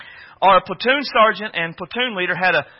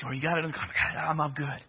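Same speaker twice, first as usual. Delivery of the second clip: very soft, low acted murmur; quiet, low undertone; hushed exchange; 4 semitones lower, 12 dB quieter, faster than usual. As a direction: keep it down, sneaking in the dark. Hushed exchange while sneaking through tunnel.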